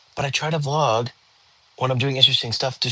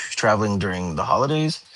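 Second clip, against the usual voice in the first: usual speaking voice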